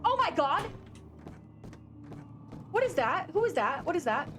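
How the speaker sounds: real shocked outcry; startled alarm. Real, not acted. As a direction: cry out as if jumped by something in the dark. Startled by something in game.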